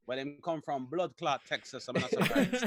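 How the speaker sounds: low, soft mock Jamaican; half-hearted Jamaican accent. Joking about a Texan's borrowed accents, half-hearted Jamaican voice. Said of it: "I was cool runnings Jamaican at best".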